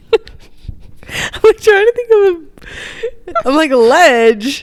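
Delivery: high, slow giggly guess; half-laughing thinking aloud; laughing while guessing. Real, not acted. Friend guesses the book, laughing about the ledge.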